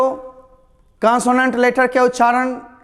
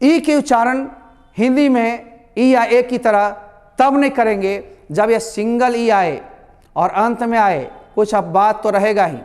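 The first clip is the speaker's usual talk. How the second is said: normal talking voice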